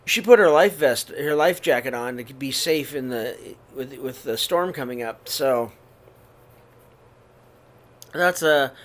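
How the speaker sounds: ordinary delivery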